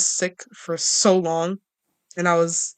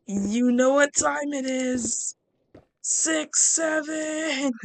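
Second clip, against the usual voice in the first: hyped meme callout; 9 semitones higher, much slower than usual. Acted. Six seven meme callout.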